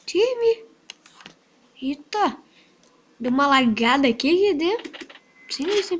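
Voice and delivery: ordinary talk, nothing marked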